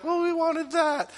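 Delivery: hammed-up whiny complaint; whiny Israelites. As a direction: whine like a spoiled child. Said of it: "and we're like".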